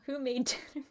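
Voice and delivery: ordinary talk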